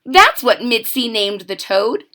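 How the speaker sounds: plain reading voice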